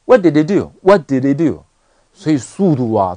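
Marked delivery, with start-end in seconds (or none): 0.0-1.6 "what did he do" run together as "wha-di-di-do"; quick "what did he do" with unreleased t; weakened, linked. Shown as a demonstration. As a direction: drop the h of "he" and link it to "did". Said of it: "就变成这个".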